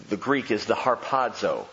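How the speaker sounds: normal talking voice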